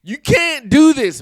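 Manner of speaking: high, loud, slowed protest; exasperated protest. Real, not acted. Vlogger calling out Trump over the commercial, exasperated protest. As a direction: plead loudly in disbelief.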